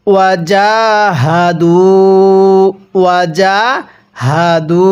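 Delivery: ওয়াজাহাদু with জা held one alif, slowly; stretched ja. Shown as a demonstration. Teacher demonstrates the stretched জা. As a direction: draw out the জা in ওয়াজাহাদু. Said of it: "জিমের মধ্যে আমরা এক আলিফ টেনে পড়ব".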